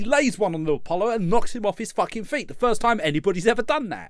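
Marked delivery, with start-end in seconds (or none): none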